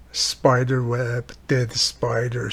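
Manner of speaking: ordinary talk, nothing marked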